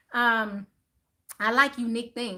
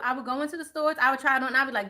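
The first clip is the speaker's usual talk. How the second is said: normal talking voice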